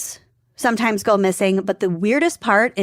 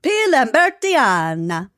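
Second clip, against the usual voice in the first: put-on higher, singsong Italian accent; hammed-up Italian accent; 4 semitones higher than usual. Podcaster repeating a tree name for her co-host, hammed-up Italian accent. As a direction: relish the name like a mock Italian. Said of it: "I'm back in Italy".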